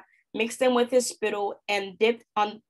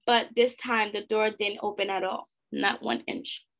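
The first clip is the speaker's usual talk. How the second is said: ordinary delivery, nothing marked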